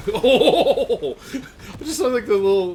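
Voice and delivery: laughing through the words. Real, not acted. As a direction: giggle while talking. Laughing at game scene.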